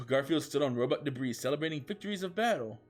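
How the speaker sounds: ordinary delivery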